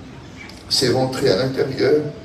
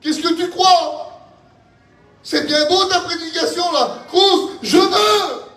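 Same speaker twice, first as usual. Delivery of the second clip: full-force preaching; 14 semitones higher than usual. Acted. Believer throwing the preaching back at the preacher, shouting. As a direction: shout it from the pulpit, stretching the key words.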